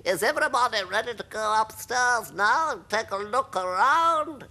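high-pitched character voice, rising; old grasshopper. Acted. Grasshopper inviting everyone upstairs, high character voice. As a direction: call the company together in a high voice.

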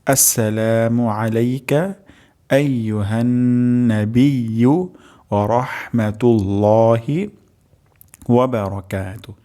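the whole phrase recited slowly and clearly; slow full recitation. Shown as a demonstration. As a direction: recite the greeting slowly, syllable by syllable. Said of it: "আরেকবার উচ্চারণ করছি".

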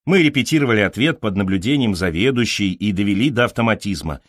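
usual speaking voice

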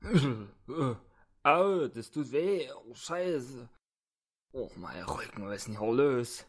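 a lightly voiced thug waking up hurt, groaning; groggy thug in pain. Thug wakes up in the cellar, lightly voiced, hurting. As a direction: groan through the aches, dazed.

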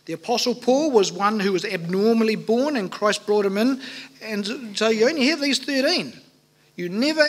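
normal talking voice

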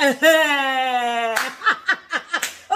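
long delighted laugh. Real, not acted. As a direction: burst out in a drawn-out laugh. Delighted by the child's attempt.

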